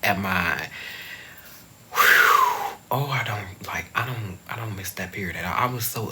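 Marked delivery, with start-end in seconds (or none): 1.9-2.9 long, loud exhale; heavy sigh. Real, not acted. Recalling how upset she was, heavy sigh. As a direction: blow out a heavy breath.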